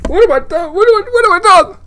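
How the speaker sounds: high, squeaky wail of horror; mock despair. Real, not acted. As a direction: cry it out in mock panic. Mock despair after a blunder.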